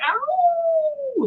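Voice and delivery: very high, drawn-out howl; coyote howl. Retold or imitated. Coyotes howling out of sight, imitated howl. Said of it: "Then they would go like".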